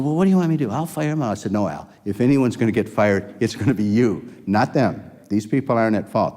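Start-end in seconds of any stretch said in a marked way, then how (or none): 0.0-1.3 high, loud, panicky manager; high-pitched flustered offer to fire staff; panicky lab head. Retold or imitated. 1.5-6.3 firm rebuke. Retold or imitated. Retold rebuke of lab head.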